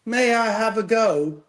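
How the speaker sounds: slow, high British-kid impression. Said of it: "I sounded like a British kid".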